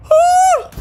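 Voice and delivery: high sudden yelp; startled yelp. Real, not acted. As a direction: yelp in shock. Sudden reaction in game.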